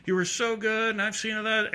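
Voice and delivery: put-on high-pitched drunk gushing on and on; high, run-together tipsy babble; rambling drunk fan. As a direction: imitate a drunk fan's endless blurry praise. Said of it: "And the guy's drunk".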